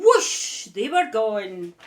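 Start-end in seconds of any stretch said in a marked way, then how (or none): 0.0-0.7 whoosh. A narrator's reading. Broomstick takes off.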